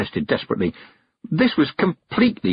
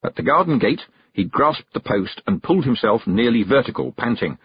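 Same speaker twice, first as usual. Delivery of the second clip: usual speaking voice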